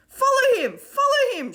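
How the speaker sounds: high, loud chanted call; shouted. A narrator's reading. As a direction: urge the crowd after him. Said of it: "shouted Davina and Stanley".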